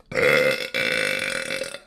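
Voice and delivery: long, low rumbling burp. Real, not acted. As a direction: let out a drawn-out burp. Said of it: "I don't know why I'm burping".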